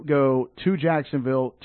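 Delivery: usual speaking voice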